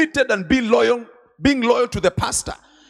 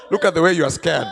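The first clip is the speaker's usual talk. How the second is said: ordinary delivery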